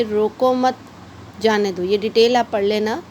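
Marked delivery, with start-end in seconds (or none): 0.0-1.8 "रोको मत" as one phrase, pause, then "जाने दो"; pause after मत. Shown as a demonstration. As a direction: run रोको into मत, break, let जाने दो fall. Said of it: "विराम चिन्हों की क्या इम्पोर्टेंस है". ("रोको मत" एक साथ, मत के बाद विराम)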